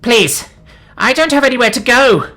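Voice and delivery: high, loud desperate begging; pleading yell. A narrator's reading. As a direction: wail it out like someone with nowhere left. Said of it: "the Skritter yelled out".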